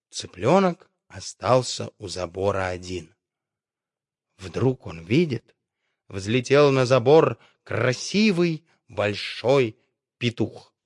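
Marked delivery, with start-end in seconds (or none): none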